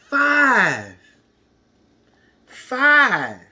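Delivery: big emphatic count; loud. Shown as a demonstration. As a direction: call out the final number. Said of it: "counting aloud for kids".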